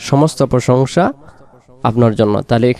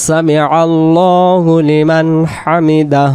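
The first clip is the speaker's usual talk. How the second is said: slow, connected recitation with held vowels; prayer recitation; 3 semitones higher, slower than usual. Shown as a demonstration. Teacher giving the whole phrase to learners, measured prayer recitation. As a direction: recite slowly, lengthening আল্লাহু. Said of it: "হায়ের উচ্চারণটা".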